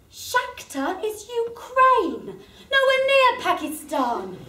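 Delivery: slow, high, disbelieving; exasperated. Real, not acted. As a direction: spell it out in disbelief. Exasperated correction.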